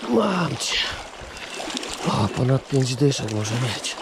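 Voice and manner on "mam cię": normal talking voice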